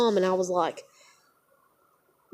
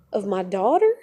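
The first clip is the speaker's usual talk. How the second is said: usual speaking voice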